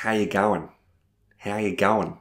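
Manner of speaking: quick, run-together Australian greeting; full-speed casual Australian; Australian accent. Shown as a demonstration. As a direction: slur it into one breath, Aussie style. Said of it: "avec un accent australien et à pleine vitesse".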